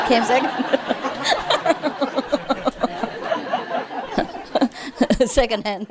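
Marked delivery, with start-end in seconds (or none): 0.5-5.2 long fading laugh; own laugh. Real, not acted. Teller laughs at his George Washington joke.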